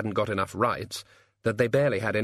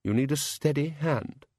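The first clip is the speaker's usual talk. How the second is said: higher-pitched, frosty dismissal; prickly, superior Jack Frost; haughty frost spirit; 5 semitones higher than usual. Acted. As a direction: dismiss them like a proud artist.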